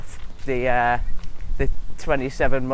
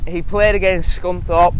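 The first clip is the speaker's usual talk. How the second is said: ordinary delivery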